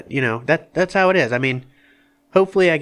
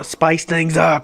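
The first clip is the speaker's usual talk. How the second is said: loud echo, higher and very drawn out; hyped up; 5 semitones higher, 5 dB louder, much slower than usual. Real, not acted. Cohost echoes the call to spice things up, hyped. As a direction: stretch it out like a hype man.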